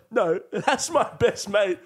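slow, loud, high mock confrontation; retold angry challenge. Friend defending his best mate from Irishman, retold angry challenge. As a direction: act out squaring up, each word drawn out.

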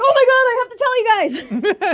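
high, laughing gush; excited laughing. Retold or imitated. Telling friends about remembering Saga's return, laughing and excited. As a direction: bubble over with giggles.

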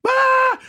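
loud, high mock scream; imitated crowd scream. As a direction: burst out like a screaming arena. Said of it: "The whole place is screaming".

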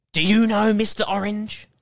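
usual speaking voice

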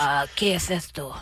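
speaking Spanish. Pretend. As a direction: switch into Spanish, lightly. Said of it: "¿Dónde está España?".

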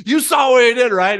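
high, loud, indignant retelling of the angry golfer; worked-up imitation. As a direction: mimic the outraged golfer pleading his case. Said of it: "the other guy's going".

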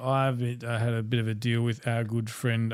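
ordinary talk, nothing marked

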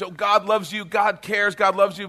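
high, singsong 'God loves you'; singsong mock preaching. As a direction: chirp it emptily, like a rote message. Mock sharing of the message.

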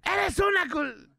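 very high, stretched-out yell cut short; acted scream. Host showing the yell the whole exam room heard, acted scream. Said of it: "que oyéramos ese grito".

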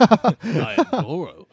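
slow, booming monster voice; Vin Diesel as Goro. Retold or imitated. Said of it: "Vin Diesel's proven he can do a voice".

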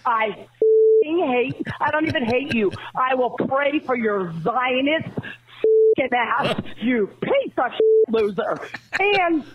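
ranting furious phone message; angry voicemail. Real, not acted. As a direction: spit out a raging voicemail. Great moments in angry voicemail history.

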